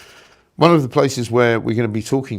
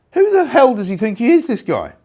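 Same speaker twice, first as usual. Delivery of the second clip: higher, louder indignant outburst; host fuming at the Prime Minister, raised voice; outraged; 8 semitones higher, 4 dB louder than usual. Real, not acted. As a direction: blast it out in disbelief. Outraged rant at the politician.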